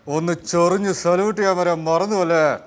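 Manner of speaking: film police officer. Acted. Officer scolding Habeeb over a salute, loud film-cop impression. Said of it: "Maniyanpilla Raju ചേട്ടനും അതുപോലെ ഭീമൻ രഘു".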